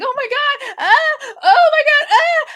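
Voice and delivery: hammed-up screaming girls in a car; panicked shriek. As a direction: shriek and whimper like a trapped horror victim. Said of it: "and they're like".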